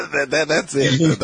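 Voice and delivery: slow, laughing it out; amused, drawn-out laughing. Real, not acted. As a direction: let the laugh carry the words. Host laughs at wife punchline.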